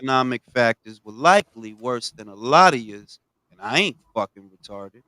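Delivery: ordinary talk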